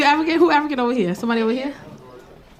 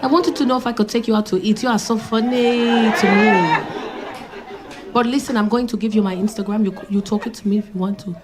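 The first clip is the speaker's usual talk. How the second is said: put-on African accent. Imitating an African man flirting.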